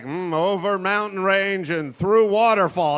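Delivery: slow, terse and grave; solemn movie-cliché guide; movie Indian guide. Pretend. Movie Indian guide solemnly directing the cowboys. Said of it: "The Indian's always just like".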